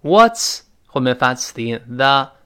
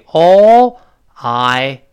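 ALL and I held long and separate; word by word; 4 semitones higher, 10 dB louder than usual. Shown as a demonstration. Teacher shows learners the words before linking, slowly separated. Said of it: "连读变成all I get".

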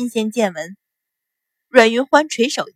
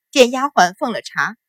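plain reading voice

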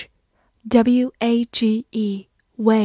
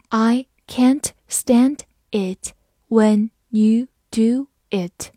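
each word separate, with clear gaps; slow it right down, word by word; slow read-along; much slower than usual. Shown as a demonstration. Teacher models the sentence for learners, slow read-along. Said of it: "来慢读一下这个句子".

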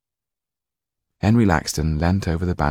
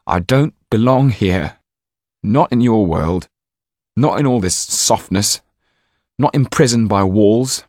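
put-on louder, higher defiant boy; gritted teeth; 4 semitones higher, 6 dB louder than usual. A narrator's reading. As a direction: force it out through clenched teeth. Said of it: "through gritted teeth".

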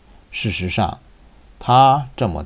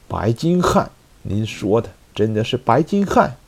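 plain reading voice

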